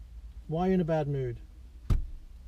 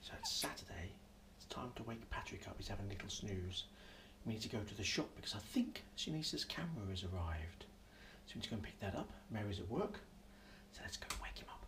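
whispering; 8 semitones lower, 18 dB quieter, faster than usual. Real, not acted. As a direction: keep it a soft whisper, don't wake him. Sneaking in on sleeping baby.

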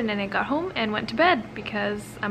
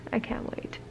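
breathy whispered echo; 10 dB quieter than usual. Real, not acted. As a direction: whisper it to yourself.

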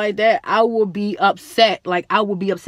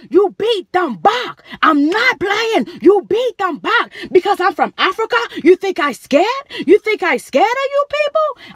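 high, loud, slowed furious rant; going off; 10 semitones higher, 4 dB louder than usual. Retold or imitated. Mother going off at the staff, loud furious rant. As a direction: tear into them, drawing out each order. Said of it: "Big Ange going off".

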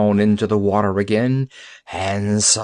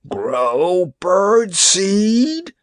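very slow, much higher, louder groan of disgust; 10 semitones higher, 4 dB louder, much slower than usual. Acted. Pirate chief groaning at the doctor's sentence, disgusted. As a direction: drag it out as if the idea sickens you. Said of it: "he groaned in disgust".

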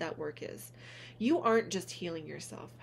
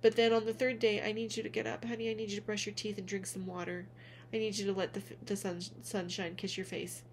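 ordinary delivery

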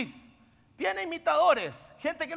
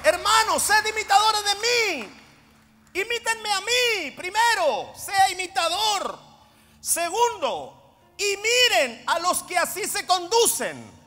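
full-force preaching; 5 semitones higher, 7 dB louder than usual. Real, not acted. Preacher urging the congregation to imitate, in full-force preaching. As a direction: proclaim each command to the whole church.

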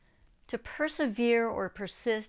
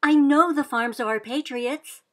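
high loud urging, stress on "know"; girl pleading to be sent; insisting; 4 semitones higher, 8 dB louder than usual. Acted. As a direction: keep pressing the case. Sybil keeps insisting.

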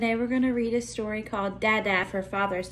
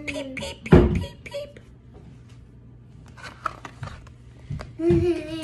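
chick peeps; 7 semitones higher, slower than usual. Pretend. Teacher reading to children, high chick peeps. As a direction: peep like a baby chick. Chick sound in picture book.

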